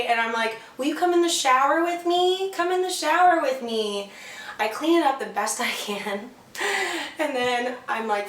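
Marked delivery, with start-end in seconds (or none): 0.8-4.1 higher, louder coaxing invite; wheedling plea. Retold or imitated. Coaxing him into shower. 6.5-7.1 high short embarrassed giggle; real amused laugh. Real, not acted. Laugh at own story.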